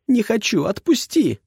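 put-on high, struggling girl's cry; young woman protesting. A narrator's reading. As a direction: yell at him while kicking. Girl struggling on his shoulder.

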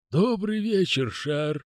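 high creaky servant greeting; a lisping manservant voice; creaking groaning door voice. Acted.

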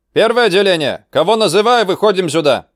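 high, loud calling out; shouted roll call. Acted. Instructor calls out squad.

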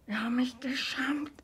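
broken whisper; ashamed whisper. Acted. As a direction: breathe the confession out. Repeated confession whispered.

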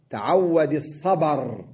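الصبر as as-sabar, stopping on ر; الصبر with open ba and silent ending; pause with vowel transfer. Shown as a demonstration. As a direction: slowly, move the a of الصبر onto the ب. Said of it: "لما أقف على الصبر أقول".

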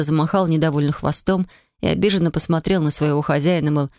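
plain reading voice

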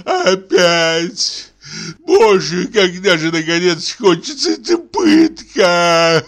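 high, slow anguished wail; suffering invalid. Acted. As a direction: wail in agony. Sick man wails in agony. (мучительный стон больного)

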